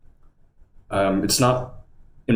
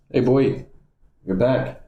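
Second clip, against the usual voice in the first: higher, bright pet-greeting voice to the dog; doggy talk; 4 semitones higher than usual. Real, not acted. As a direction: greet the dog like a happy owner.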